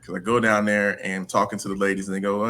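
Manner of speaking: usual speaking voice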